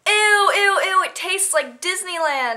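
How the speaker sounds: high, drawn-out squeal of disgust; mock disgust. Guy walking past spraying water, mock disgusted.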